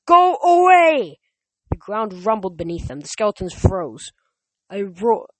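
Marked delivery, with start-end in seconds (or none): 0.0-1.2 long, drawn-out high scream; louder shout. A narrator's reading. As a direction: bellow slowly to banish them. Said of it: "Nico shouted louder".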